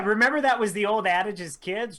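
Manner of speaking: ordinary talk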